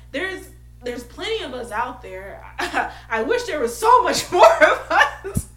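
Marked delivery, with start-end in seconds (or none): 2.6-5.5 laughing through the words; giggling while talking. Real, not acted. Laughs mid-sentence.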